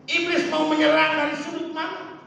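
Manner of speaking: high, challenging preacher's questions; fervent preaching. Acted. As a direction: throw the question out to the congregation. Preaches with force. (bertanya lantang seperti pengkhotbah)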